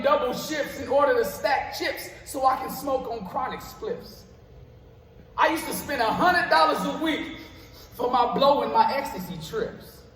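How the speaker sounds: rhythmic, punchy spoken word delivery, words hit on the beat; spoken word poem. Acted. Poet performing his piece for a live crowd, rhythmic spoken word. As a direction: perform it like a slam poet on stage.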